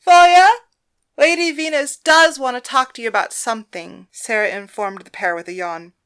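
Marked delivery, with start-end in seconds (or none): 0.0-4.1 high, loud call across the room. Acted. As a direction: call her name loudly. Sarah calling out across lounge.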